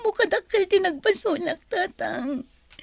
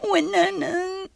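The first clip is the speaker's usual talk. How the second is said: normal talking voice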